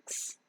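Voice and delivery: "X" as a bare "ks"; a quick voiceless "ks" for "X"; K-S phonic sound. Shown as a demonstration. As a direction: say "X" as K run into S, no vowel. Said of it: "combination of k and s sound".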